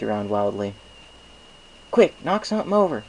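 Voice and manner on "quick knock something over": high, loud urgent order. Acted. Applejack urges the stallion to knock something over, high and urgent. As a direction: hurry him along.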